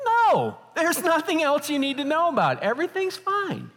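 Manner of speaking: very high, overdone insistence; hammed-up panicky cover-up; mock defensive denial. Retold over-hasty denial to doctor.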